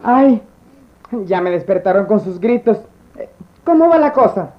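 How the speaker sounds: señora recién despierta; voz grave de doña adormilada; voz de señora somnolienta. Acted. La clienta despierta. (voz de señora)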